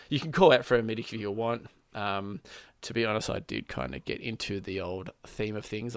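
normal talking voice

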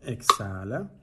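soft, slow counting of breaths, drawn-out rising ends; guided breath counting. Acted. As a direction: count the breath gently for a meditation. Cuenta respiraciones en meditación. (conteo de respiración suave y pausado)

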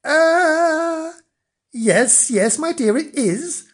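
high, hesitant placating; stall, then agree in a high, meek voice; nervous high-pitched reassurance; flustered. A narrator's reading. Husband covers up to his wife.

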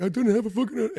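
high-pitched mock indignation; flustered, mimicked protest; worked up. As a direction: imitate a young apprentice getting riled. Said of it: "gets so easily worked up".

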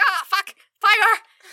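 high, loud, slow cry; hammed-up high alarmed shout; mock alarmed shout. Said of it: "Barbarella's just like".

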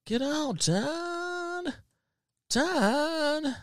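high, drawn-out mimicry of a son; mock whining son. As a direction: imitate a son pleading with his dad in a high voice. Mimics son whining at his dad.

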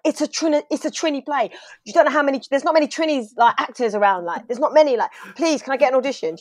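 high-pitched, urgent pitch to an agent; breathless-to-convince reenacted plea; excited pleading. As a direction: gush and beg for a chance, retold. Acted plea to her agent.

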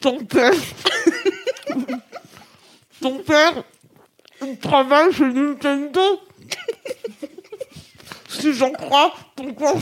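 stuffed mouth, garbled words; mouth full. Real, not acted. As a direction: declaim through a full mouth. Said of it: "six marshmallows in mouth".

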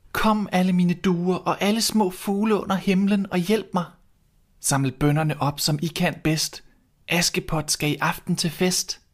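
high, calling to the birds. Acted. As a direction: call the doves for help. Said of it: "råbte". (høj, kaldende stemme til fuglene)

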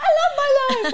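high, loud laughing call; imitating a child. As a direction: cheer it like a happy boy. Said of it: "Harry's like".

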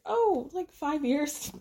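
high-pitched imitation of offhandedness; mock casual downplaying. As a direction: toss it off high, as if trifling. Acts him downplaying a long break.

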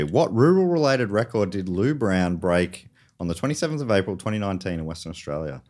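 ordinary delivery

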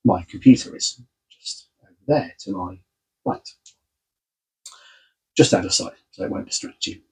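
ordinary talk, nothing marked

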